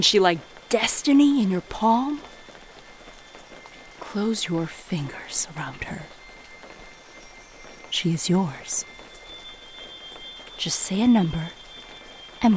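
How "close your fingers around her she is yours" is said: hushed, coaxing whisper. Acted. Merchant whispering to the customer to hold the gem. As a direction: whisper it like a seduction.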